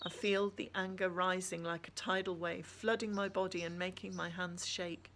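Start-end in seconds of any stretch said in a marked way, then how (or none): none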